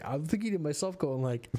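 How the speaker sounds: ordinary talk, nothing marked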